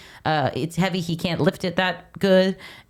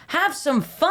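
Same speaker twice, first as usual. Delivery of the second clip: high-pitched mock coach cheer; encouraging coach; 11 semitones higher, slower than usual. Podcaster quoting Hal to correct co-host, high mock cheer. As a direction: call it out high, as playful encouragement.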